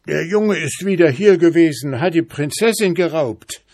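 put-on squeaky horse telling on the boy; three-legged horse. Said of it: "antwortete der dreibeinige Schimmel".

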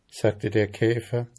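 ordinary delivery, nothing marked

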